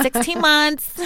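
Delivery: high, drawn-out mock parent brag; doting parent act. Telling the host the relationship's age like a baby's, cooing. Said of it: "I'm pretending like it's a child".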